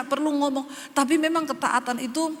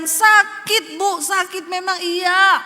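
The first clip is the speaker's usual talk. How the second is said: sharply raised, loud cry of pain; preaching shout; 4 semitones higher, 6 dB louder, much slower than usual. Real, not acted. Preacher crying out to the congregation, loud and high. Preacher presses a painful point.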